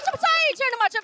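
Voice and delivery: high, loud comic outburst; indignant exclamation. Retold or imitated. Narrator answering colleague's rumour about herself, indignant shout. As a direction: shout it in mock disbelief.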